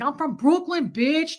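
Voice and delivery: mock a loudmouth's Brooklyn accent; big Brooklyn brag, sing-song. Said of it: "I switched up my accent".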